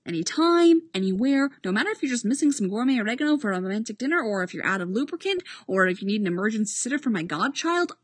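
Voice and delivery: fast, breathless list of offers; rapid rambling. Acted. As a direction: rattle it off quickly. Boyle rattles off offers.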